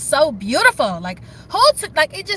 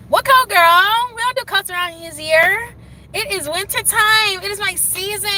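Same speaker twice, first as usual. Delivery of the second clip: sassy; 8 semitones higher, slower than usual. Real, not acted. Sassy aside about coats.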